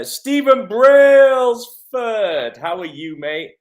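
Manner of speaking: high, loud, drawn-out name call; hailing greeting. Real, not acted. Host greets a viewer joining the live, loud and drawn out. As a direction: stretch the name out like a big cheer.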